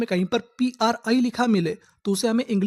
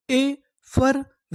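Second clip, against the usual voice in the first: Effervescence drawn out slowly, higher, syllable by syllable; slow demo; 4 semitones higher, much slower than usual. Shown as a demonstration. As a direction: pull effervescence apart slowly. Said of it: "तो हमारी पूरी meaning क्या बनी?". (Effervescence धीरे-धीरे तोड़कर)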